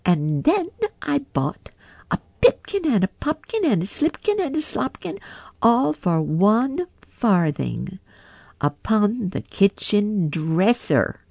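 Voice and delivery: high, teasing mouse voice; rude little mice. A narrator's reading. Mice taunting Simpkin, high teasing. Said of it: "added the rude little mice".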